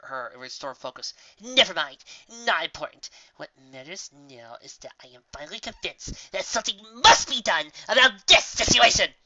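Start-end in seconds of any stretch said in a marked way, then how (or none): 1.4-9.2 grand resolve. Acted. Trixie resolving to act.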